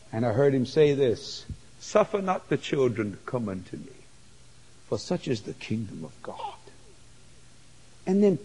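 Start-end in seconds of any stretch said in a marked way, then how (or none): 1.8-6.6 low, soft and gentle; hushed tender scripture voice; tender voice of Jesus. Retold or imitated. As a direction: speak it like a quiet blessing. Quoting Jesus in heaven.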